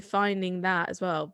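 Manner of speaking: ordinary delivery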